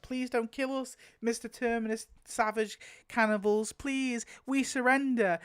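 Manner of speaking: high-pitched hammed-up begging; mock pleading. Pretend captives begging Terminus cannibals for mercy, mock pleading. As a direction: plead in a put-on high, helpless voice.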